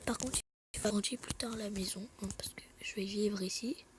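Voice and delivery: hushed low murmur while playing; whispered commentary. Real, not acted. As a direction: talk under your breath. Whispered gameplay narration. (commentaire chuchoté)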